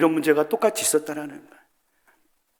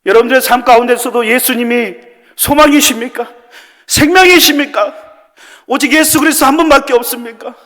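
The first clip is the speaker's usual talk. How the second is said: raised, impassioned pulpit appeal; fervent preaching; 8 semitones higher, 7 dB louder than usual. Acted. Pastor urging the congregation to pray: loud fervent preaching. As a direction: plead with the church at full force.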